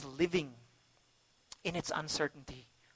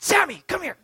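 calling out; 12 semitones higher, 9 dB louder than usual. Retold or imitated. Dying friend calls him over.

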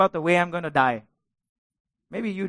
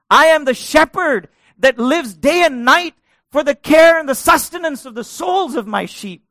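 voicing Jesus; 5 semitones higher, 6 dB louder than usual. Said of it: "Jesus is saying".

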